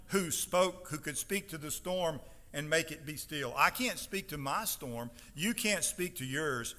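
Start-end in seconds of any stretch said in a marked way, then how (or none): none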